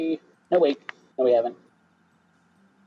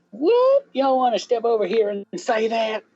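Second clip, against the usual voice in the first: high-pitched Southern challenge; indignant Southern accent; 9 semitones higher than usual. Acted. Southern witness challenging the critical lawyer, indignant Southern accent. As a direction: squawk it, daring them in Southern.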